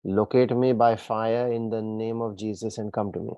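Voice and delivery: ordinary talk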